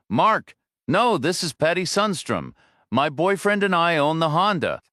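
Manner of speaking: woman explaining herself on the phone, lightly voiced; woman's voice, lightly voiced. A narrator's reading. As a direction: narrator lifts pitch for the woman.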